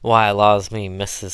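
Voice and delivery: loud exclamation with a touch of amusement; lightly laughing servant's protest, loud; amused. A narrator's reading. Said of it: "said Chloe, laughing again".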